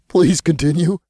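high, squeezed through snickers; stifling laughter. A narrator's reading. Imp urges Archangel on, stifling laughter. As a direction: try not to lose it.

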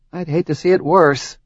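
wry line with a small laugh; rueful laugh. Acted. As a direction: laugh it off lightly. Rueful joke.

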